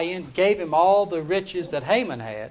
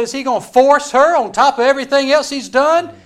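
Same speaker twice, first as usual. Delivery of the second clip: loud, raised-pitch accusing question; outraged shout; 9 semitones higher, 11 dB louder than usual. Acted. King finding Haman on top of his wife, outraged shout. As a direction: thunder it in acted outrage.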